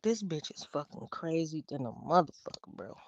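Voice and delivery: thinking the roommate is crazy; muttered inner thought. Retold or imitated. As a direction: say it under your breath. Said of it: "I'm like".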